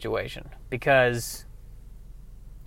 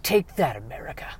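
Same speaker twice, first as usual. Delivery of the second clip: quieter take; 9 dB quieter than usual. Acted. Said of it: "for the sake of that line right there".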